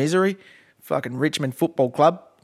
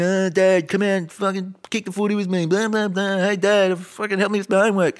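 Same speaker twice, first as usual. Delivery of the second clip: put-on whiny kids; 3 semitones higher than usual. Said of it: "screaming in my ear, wanting to".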